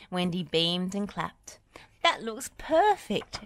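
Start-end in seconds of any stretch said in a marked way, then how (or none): 2.0-3.4 cried out. A narrator's reading. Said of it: "she cried".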